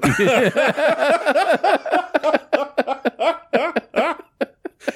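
long high-pitched laugh; high laughter. Real, not acted. Friend laughing at the absurd video, high laughter. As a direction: break up laughing.

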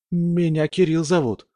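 put-on high nervous young man voice; испуганный юноша. Испуганный парень. (нервный высокий голос юноши)

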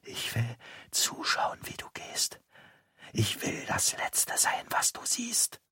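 slow, soft dying rasp; rasping whisper. A narrator's reading. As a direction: pull him closer with your last breath. (langsames, heiseres Sterbeflüstern)

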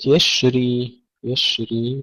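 يشري with a long hissed sh, no bounce; spreading shin. Shown as a demonstration. Teacher modelling for students, airy shin in يشري. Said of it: "تفشي الشين".

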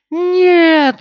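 high, desperate scream. A narrator's reading. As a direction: scream it in horror. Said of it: "закричала Найниф".

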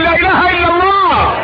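high, slow, full-throated call; proclaiming shout. Acted. Preacher calling listeners to the creed, shouted high. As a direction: lead the crowd in the creed. Crowd called to proclaim faith.

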